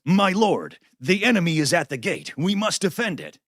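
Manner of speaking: put-on loud, high old-time salesman pitch; 1940s used car salesman. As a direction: proclaim it like a forties car salesman. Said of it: "used car salesman from the nineteen forties".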